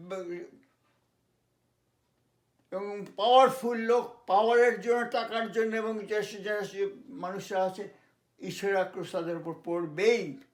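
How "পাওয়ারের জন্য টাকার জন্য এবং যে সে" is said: ordinary delivery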